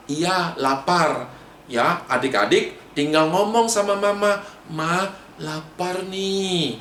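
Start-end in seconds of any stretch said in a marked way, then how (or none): 4.7-6.8 soft, slow polite request to mom; small, courteous plea. Shown as a demonstration. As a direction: ask mom gently, drawing it out. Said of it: "Ya, mesti sopan".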